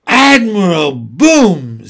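slow, loud surprised exclamation; amazed, slowly. A narrator's reading. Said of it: "looking very surprised".

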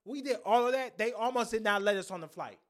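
loud rant. Real, not acted. Ranting about nearly missing flight.

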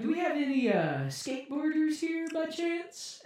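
high put-on announcer calling out; slow, high imitation of a host; event host imitation. Organisers asking the crowd for skaters, high imitated host voice. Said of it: "And they were like".

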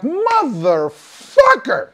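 slow, high, stretched-out curse; drawn-out exasperation. Retold or imitated. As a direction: groan it out in frustration. Podcast topic just scooped.

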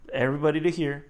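ordinary talk, nothing marked

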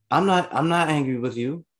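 imitating a girlfriend insisting she's not angry. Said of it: "you're raising your voice while we're in public".

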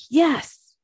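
high, emphatic burst of agreement; full-bodied yes. Acted. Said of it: "that is a full-bodied yes".

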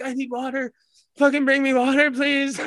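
high, strained mock begging; desperate plea. Man on bathroom floor begging for water, desperate plea.

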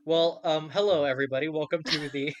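upbeat show-opening announcer read; podcast host intro. Acted. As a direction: welcome the listeners like a radio host.

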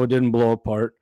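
ordinary talk, nothing marked

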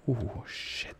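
whispered "oh shit" in fear; scared whisper. Real, not acted. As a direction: hush it out in fear. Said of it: "Okay, I'm scared".

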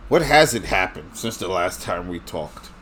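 normal talking voice

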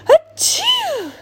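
big squeaky dragon sneeze; loud high sneeze. Acted.

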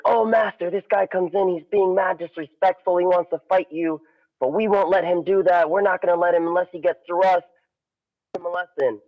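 high, hurried students tattling to master; worked-up pupils, last line fast; eager students. Retold or imitated. Students report to their master.

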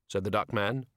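usual speaking voice

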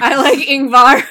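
loud laughing declaration. Real, not acted. Cohost teases her crush, loud laughing claim. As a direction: blurt it out with a laugh. Excited declaration of love for character.

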